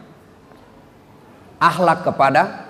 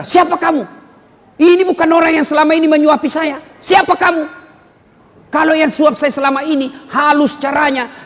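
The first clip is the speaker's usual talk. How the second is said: higher, sharp demand, shouting on the middle line; angry challenge; 8 semitones higher than usual. Acted. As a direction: confront the stranger who fed you. Blind man rejects a stranger. (tantangan marah, suara lebih tinggi)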